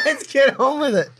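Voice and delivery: mock urging. Urging the others to move on.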